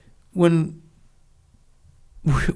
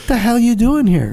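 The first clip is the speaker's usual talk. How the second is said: raised, pitched-up drill instructor bark; mock instructor yell; 8 semitones higher than usual. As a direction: bark it like a tough instructor catching you. Instructor yelling at trainee.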